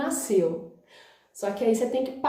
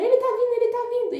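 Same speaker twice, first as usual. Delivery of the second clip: much higher, louder excited cry; 13 semitones higher, 4 dB louder, much faster than usual. Retold or imitated. Nurse telling the mother the baby is coming, excited. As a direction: announce it with a thrill.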